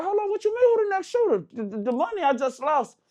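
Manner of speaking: high, incredulous pushback; can't believe what he's hearing; indignant protest. Retold or imitated. Protesting the lost money.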